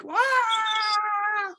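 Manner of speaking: imitated pained cry. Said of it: "it was like".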